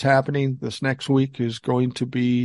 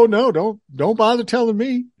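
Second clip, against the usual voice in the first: higher mocking boast, louder, voice soaring on Free; mock gloating; 7 semitones higher, 5 dB louder than usual. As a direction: taunt the scammer with a fake boast. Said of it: "I go".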